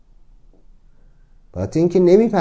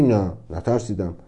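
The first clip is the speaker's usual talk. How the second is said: lower, quieter brush-off; dismissive denial; 6 semitones lower, 7 dB quieter than usual. Retold or imitated. A man asked if he's upset brushes it off quietly. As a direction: shrug the question away, low and flat. Men denying feelings, acted.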